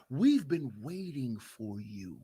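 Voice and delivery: soft, low sinister welcome; hushed ominous greeting; creepy archons. Retold or imitated. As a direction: lure him in like a cult. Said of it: "you know what they're gonna say".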